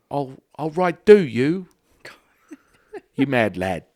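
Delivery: high, loud hammed-up Aussie accent; mock Australian. Host clowning in a mock Australian accent for his co-host. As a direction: ham up a cartoon Australian accent. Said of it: "That sounds a little English to me".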